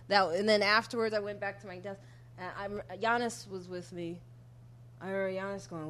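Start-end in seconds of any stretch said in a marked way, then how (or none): none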